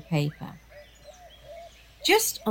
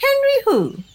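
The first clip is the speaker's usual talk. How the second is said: high Diva voice; 6 dB louder than usual. Acted. Diva feigns ignorance.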